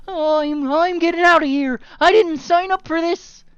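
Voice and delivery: high loud frightened voice; skittish panic. Acted. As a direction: panic and bolt. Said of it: "looks a little skittish".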